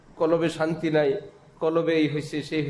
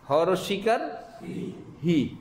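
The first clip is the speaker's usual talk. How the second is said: ordinary delivery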